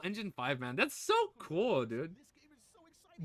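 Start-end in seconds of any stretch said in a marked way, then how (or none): 2.2-3.2 whispered, fast; excited whisper. Real, not acted. Streamer whispering excitement about the game.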